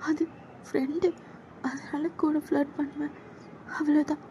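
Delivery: put-on timid young woman begging him to stop; scared junior girl. Junior girl begging her senior to back off, high and timid.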